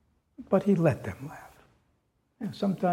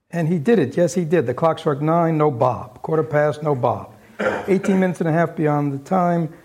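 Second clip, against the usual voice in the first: lively, louder storytelling read; story narration; 5 dB louder than usual. A narrator's reading. Teacher reading Dickens to listeners, animated narration. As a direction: tell it like a tale to the room.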